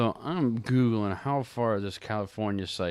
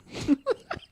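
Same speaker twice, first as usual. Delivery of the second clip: high giggle at her own joke; corny dad laugh. Real, not acted. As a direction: laugh before the punchline.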